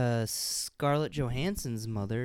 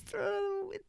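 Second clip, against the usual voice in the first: 1 squeaky echo; 8 dB quieter, faster than usual. Pretend. Echoing in a squeaky voice.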